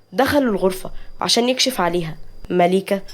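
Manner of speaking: ordinary delivery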